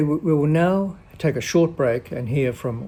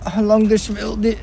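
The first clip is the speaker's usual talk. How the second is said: put-on high, loud complaining old patient voice; whiny high patient; 9 semitones higher, 6 dB louder than usual. Patient in salon chair complains loudly in high voice. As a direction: moan like a fed-up invalid.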